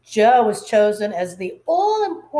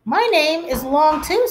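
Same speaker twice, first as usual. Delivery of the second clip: teacher's voice; 7 semitones higher, 3 dB louder than usual. Acted.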